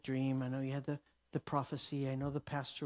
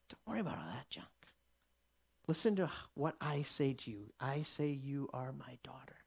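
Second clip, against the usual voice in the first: soft, much quieter whispered comfort; a fast hushed whisper, much softer; tender whisper; 4 semitones lower, 7 dB quieter than usual. Acted. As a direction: breathe it like a father soothing his child. God comforting the woman, whispered.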